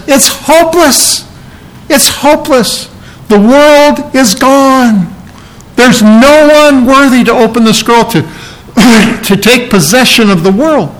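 high, strained, despairing cry; breaking up in despair. Acted. John weeping that no one is worthy, high despairing cry. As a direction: let the voice crack with hopeless grief. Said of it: "John here is totally breaking up".

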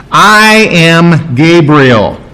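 drawn-out, weighty self-announcement; slow, emphatic proclamation; emphatic declaration. Acted. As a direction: declare who you are, stretching the words out slowly. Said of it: "That's an extremely emphatic statement".

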